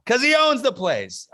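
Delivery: punchline repeated high and loud; punchline replay. Shown as a demonstration. The teacher replays the joke's last line, high and loud. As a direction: hit the punchline again with gusto. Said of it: "Thank you".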